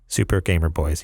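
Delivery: normal talking voice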